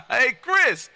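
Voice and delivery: ordinary delivery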